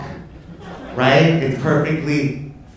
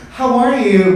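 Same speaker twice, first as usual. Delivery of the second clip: fake-friendly greeting; 11 semitones higher, 4 dB louder than usual. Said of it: "would be like".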